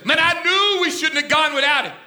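high, loud mock griping; griping disciples. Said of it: "they're saying".

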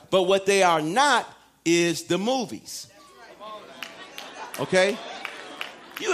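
ordinary talk, nothing marked

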